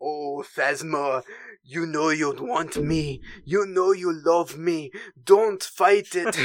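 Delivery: slow, seductive French-accented cartoon suitor. Agent Terex wooing Phasma, seductive French accent. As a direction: woo her in a drawn-out Pepe Le Pew French accent. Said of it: "immediately went to Pepe Le Pew every time he talked".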